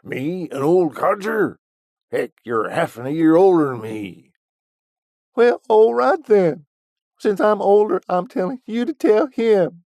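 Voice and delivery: high, loud cranky geezer voice; outraged old man Willie. A narrator's reading. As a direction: squawk like an offended old codger.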